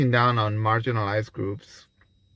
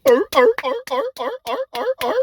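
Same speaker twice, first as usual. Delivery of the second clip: high barking like trained seals; mock audience barking; much faster than usual. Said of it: "Just listen to the fucking audience".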